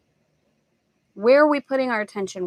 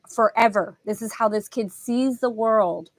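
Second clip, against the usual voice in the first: ordinary talk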